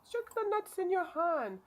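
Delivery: put-on high, loud, slow Indian accent. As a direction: imitate a doctor with an Indian accent. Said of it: "I was doing an Indian accent".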